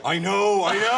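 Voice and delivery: high, loud dolphin retort; commiserating dolphin. Acted. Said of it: "other dolphin would look at him and go".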